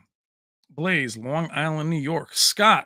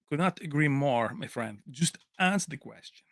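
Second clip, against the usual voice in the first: put-on Van Damme accent; 5 dB quieter than usual. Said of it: "I do good accents".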